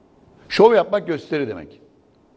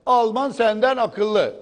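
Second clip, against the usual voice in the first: slow, high, drawn-out needling; provocatively slow and pointed; deliberate provocation; 8 semitones higher, much slower than usual. Real, not acted. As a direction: stretch it out to wind up the trolls. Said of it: "mahsustan böyle yapıyorum ki hoplasın".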